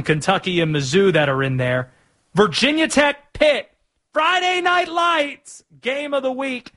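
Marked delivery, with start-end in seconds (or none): 2.3-6.7 hype announcer. Acted. Hype call of the matchup.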